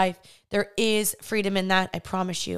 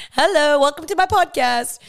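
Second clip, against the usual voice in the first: higher and louder, hammed-up host hello; mock podcast host greeting; 5 semitones higher, 6 dB louder than usual. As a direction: greet the audience like a cheesy show host with a laugh. Opening greeting of her podcast.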